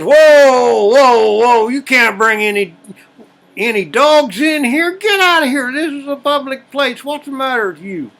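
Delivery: loud, high bartender shouting the man out; angry bartender yelling. Acted. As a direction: throw him out, yelling hard. Bartender yells at blind man's dog.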